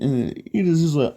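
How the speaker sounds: ordinary delivery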